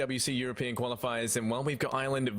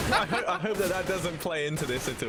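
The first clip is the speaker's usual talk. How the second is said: laughing through the words, pitched up; 6 semitones higher, 4 dB louder than usual. Real, not acted. Caster joking about the coach's terrible microphone, laughing. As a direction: giggle as you joke about the bad mic.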